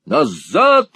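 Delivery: loud, high barked command; high, forceful yell; shouted order. A narrator's reading. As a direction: shout them back sharply. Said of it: "крикнул Сквирс".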